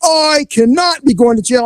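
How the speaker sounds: high, indignant imitation of a suspect; mock defiance. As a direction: protest up high, hammed-up and defiant. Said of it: "he became a little defiant".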